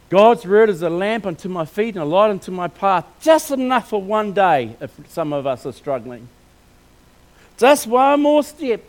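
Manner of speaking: loud, rousing sermon voice, slowing on key phrases; preaching. Acted. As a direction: urge the congregation on with full force.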